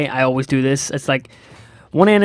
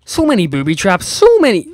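exasperated; 5 semitones higher, 5 dB louder than usual. Real, not acted. Gamer facing endless booby traps, high exasperated whine. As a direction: stretch the so way up in disbelief.

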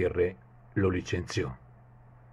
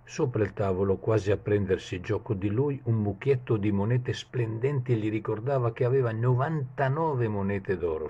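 ordinary delivery, nothing marked

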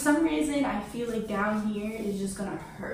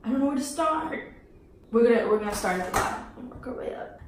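ordinary delivery

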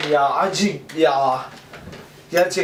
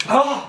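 pained gasp; 9 semitones higher than usual. Real, not acted. Lips burning.

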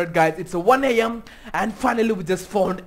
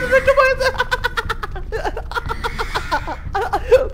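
loud, high-pitched nervous cackle; high nervous laugh; 13 semitones higher, 6 dB louder than usual. Real, not acted. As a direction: laugh it off, rattled. Nervous laugh at creepy dolls.

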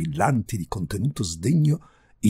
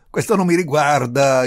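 loud, raised cut-in; interrupting; 5 semitones higher, 8 dB louder than usual. A narrator's reading. Visitor cutting the countess short, interrupting. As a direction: cut across her firmly. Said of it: "interruppe l'altro".

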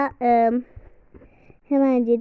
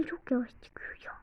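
whisper; 10 dB quieter than usual. Real, not acted. Complaining under breath.

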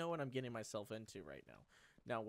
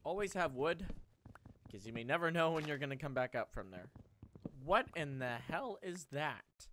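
ordinary talk

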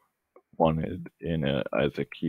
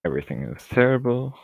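mock despair; 7 semitones higher, 4 dB louder than usual. Mock despair over bad luck.

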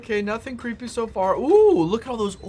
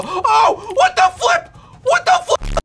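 startled shout; 6 dB louder, slower than usual. Real, not acted. Gamer startled in game.

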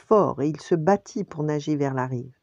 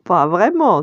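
giggle, then a louder amused reply; failing to hold back laughter; 5 semitones higher, 10 dB louder than usual. A narrator's reading. Nala answering Simba's roar, trying not to laugh. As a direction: let a laugh slip out before answering. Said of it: "essaya en vain de se retenir de rire".

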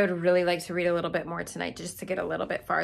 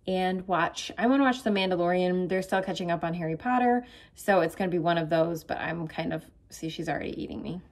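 ordinary talk, nothing marked

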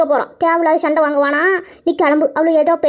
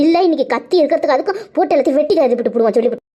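ordinary talk, nothing marked

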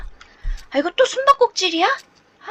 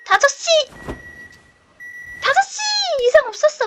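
loud, higher cheering; louder, higher victory shout; elated; 8 semitones higher, 3 dB louder than usual. Real, not acted. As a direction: shout it out in relief and triumph. Reaching five o'clock.

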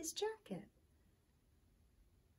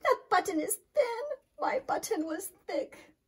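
much higher, louder, near tears; tearful shout; 10 semitones higher, 9 dB louder than usual. Acted. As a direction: shout through frustrated crying. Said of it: "shouted Toad".

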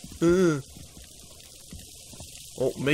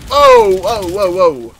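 high, loud startled cries; shocked whoa; 11 dB louder, much slower than usual. Real, not acted. Player shocked by sudden action on screen. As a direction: caught completely off guard.

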